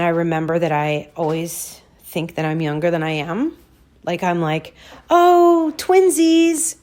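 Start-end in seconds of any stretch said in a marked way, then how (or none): 5.1-6.8 high, loud gushing 'twinsies'; mock delighted squeal. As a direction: squeal it like meeting a long-lost twin. Mock excitement at lookalike woman.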